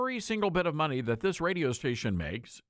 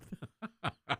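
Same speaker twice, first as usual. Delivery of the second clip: short quiet chuckle; amused breathy laugh; soft laugh; 3 semitones higher, 8 dB quieter than usual. Real, not acted. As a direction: let out a small laugh. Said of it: "The reason I'm laughing".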